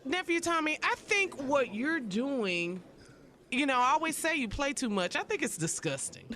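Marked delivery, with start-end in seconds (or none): none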